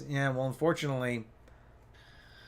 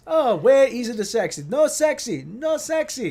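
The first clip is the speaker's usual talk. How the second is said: loud, high, hammed-up Italian accent; Italian mechanic; 10 semitones higher, 7 dB louder, slower than usual. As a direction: call out and protest as a mock Italian mechanic. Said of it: "typical Italian mechanic".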